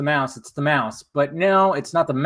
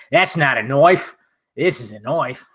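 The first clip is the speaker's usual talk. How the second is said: Australian accent. Shown as a demonstration. Streamer showing viewers his attempt at an Australian accent. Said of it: "tried to do an Australian accent".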